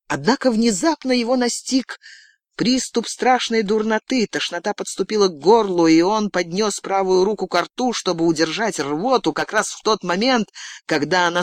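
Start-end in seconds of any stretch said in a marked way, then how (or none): none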